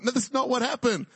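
ordinary delivery

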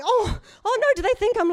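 high, loud, worried yelp; mock alarm. Mum reacts to her kids' remark, mock alarm. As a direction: blurt it out high in retold dismay. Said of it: "I was really worried about this".

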